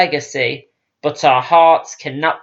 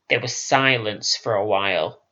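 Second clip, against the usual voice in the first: usual speaking voice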